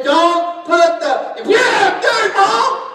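high, loud retold shout; mom yelling. Son retells mom yelling about his album, loud shout. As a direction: yell it like an angry mom.

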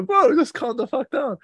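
high mock retort; high mock reply to the boxer; mock telling off. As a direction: fire it back in a high mock voice. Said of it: "I was like".

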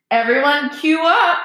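high, loud mocking call; roared to crowd. A narrator's reading. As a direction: holler it out like a jeering announcer. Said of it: "Malfoy roared to the crowd".

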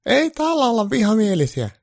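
put-on high, odd old granny denying it; grumpy old granny. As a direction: play a sharp old granny. Grandma character voiced.

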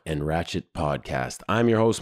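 ordinary delivery

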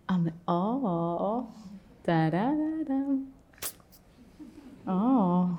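soft, slow, intrigued hums; playful curiosity. Pretend. Teacher shows the curious meditator, hushed playful wonder. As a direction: lean in with quiet wonder.

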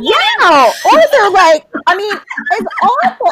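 loud laughing exasperation; nearly shouting it's awful; worked-up storyteller; loud, high excited agreement. Real, not acted.

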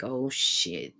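low, soft dreading aside; viewer's dread. Retold or imitated. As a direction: let it drop low and quiet, like bracing for trouble. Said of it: "you already watching it like".

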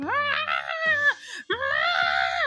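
high piercing mock scream; hammed-up shrill plant scream. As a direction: shriek like a wounded plant. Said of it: "And you can hear the scream".

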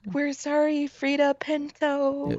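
high-pitched playful apology, drawn out; slow, high, jokey sorry; mock apology. Joint mock apology to the actors.

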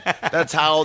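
normal talking voice

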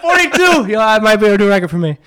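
gasping high after holding breath; panting excitedly, very fast. Real, not acted. Just finished holding breath.

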